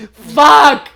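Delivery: high, loud curse; real laughing outburst. Real, not acted. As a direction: shout it helplessly after laughing. Overcome by own joke.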